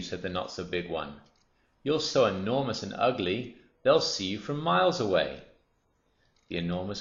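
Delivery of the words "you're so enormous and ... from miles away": higher, louder storybook jeer; animated, insulting crocodile; scornful smaller crocodile. Acted. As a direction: jeer at the big croc.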